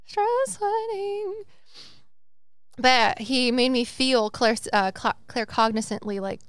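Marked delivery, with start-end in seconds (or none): 0.0-1.4 high, slow, drawn-out mock repeat; exaggerated repeat. Repeating her confession to the cohost, high and slow. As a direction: stretch it out high for comic effect.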